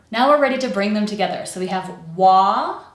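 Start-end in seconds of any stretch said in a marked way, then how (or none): 2.1-2.8 long, rising "wa"; "wa" held long and left hanging; stretched syllable. Shown as a demonstration. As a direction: draw out "wa" with an open vowel. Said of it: "make sure to make that one stretched".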